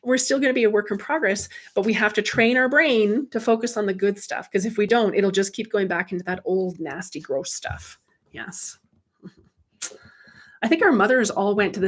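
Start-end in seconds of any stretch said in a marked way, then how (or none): none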